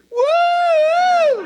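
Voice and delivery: loud, high whoop out a car window; car-window woo. Retold or imitated. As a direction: whoop like a drunk idiot. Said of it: "lean out car windows going".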